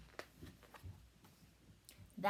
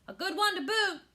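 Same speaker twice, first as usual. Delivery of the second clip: higher, louder crew echo; chorus back in a higher voice; crew agreeing; 9 semitones higher, 6 dB louder than usual. A narrator's reading. Crew echoes the captain, higher and louder. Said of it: "the others agreed".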